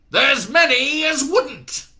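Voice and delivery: slow, very loud gruff boom; crashing back loud. Acted. Shadwell booming down the phone at Newton. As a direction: slam the words out. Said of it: "then crashed back to full volume".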